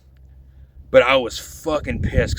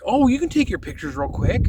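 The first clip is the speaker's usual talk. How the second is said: mock-sweet family; 11 semitones higher than usual. Mimics the family's offer.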